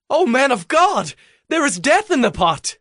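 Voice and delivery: high, alarmed outcry to the man of God; crying out. Acted. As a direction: cry out in sudden alarm. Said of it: "they cried out".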